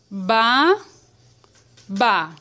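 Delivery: "ba-, ba" as two isolated syllables; long pause between "ba-" and "ba". Shown as a demonstration. Teacher sounding out a word for pupils, syllable by syllable. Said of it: "Se eu for separar a sílaba".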